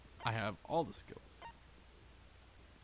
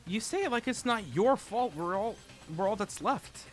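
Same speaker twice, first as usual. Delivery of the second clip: higher, louder character read; 10 semitones higher, 8 dB louder than usual. Acted. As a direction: say it as the protagonist, indignant. Rereads line in character.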